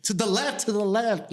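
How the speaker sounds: high-pitched, exasperated repeat; insistent repeated directions. Retold or imitated. Worker repeating himself to a slow listener, insistent and high-pitched.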